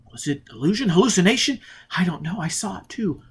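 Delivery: searching for an answer; uncertain Robin. Acted. Robin admitting to Foyle she saw it too, unsure.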